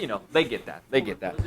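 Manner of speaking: ordinary talk, nothing marked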